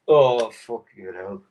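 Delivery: a long despairing "oh" before the swear; exasperated groan. Real, not acted. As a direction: let it out like your team just blew it again. Reacting to his team's bad result.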